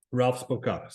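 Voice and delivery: ordinary delivery, nothing marked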